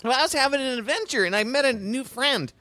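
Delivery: put-on high little-boy voice; eager kid. Kid tells family about new friend, eager. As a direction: report it proudly like a child.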